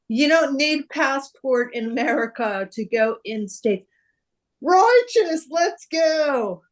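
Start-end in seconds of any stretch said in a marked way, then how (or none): 4.6-6.6 high loud stoked exclamation; surfer dude. Acted. Dude cheering his buddy, high loud stoked exclamation. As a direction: cry righteous like a stoked surfer. Bill and Ted catchphrase.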